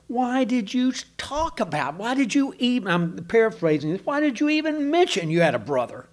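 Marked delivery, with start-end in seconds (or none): 0.1-2.9 put-on an old father throwing up his hands at his sons; exasperated father. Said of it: "He's just exasperated". 4.0-6.1 put-on an old father throwing up his hands at his sons; exasperated father. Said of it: "He's just exasperated".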